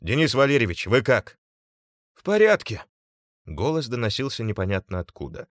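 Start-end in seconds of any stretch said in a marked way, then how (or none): 0.0-1.4 louder, raised voice checking on someone; concerned shout, moderately loud; calling out. A narrator's reading. Calling to an unseen injured man. 2.1-2.8 high, loud shout from a distance; strained high reply, shouted; calling back. A narrator's reading. Unseen man calls back from afar.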